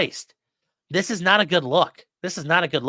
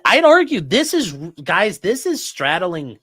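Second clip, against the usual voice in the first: exasperated outburst; 4 semitones higher, 5 dB louder, slower than usual. Real, not acted. Exasperated rant about bad motion.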